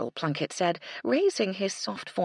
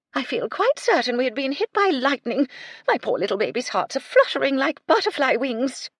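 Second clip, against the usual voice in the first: put-on high, louder breathless old lady; a fluttery lady still reeling; gasping dowager; 9 semitones higher, 6 dB louder than usual. A narrator's reading. Lady Belville shaken by the thunder, gasping. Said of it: "she said, gasping".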